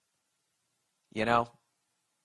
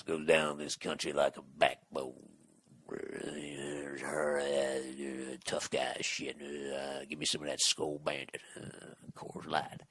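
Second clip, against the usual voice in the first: low, quiet, gravelly-free tough-guy announcer parody, lower and softer; mock macho beer ad voice; 11 semitones lower, 9 dB quieter than usual. Comic mocking Coors ads, in a low, soft tough-guy ad voice.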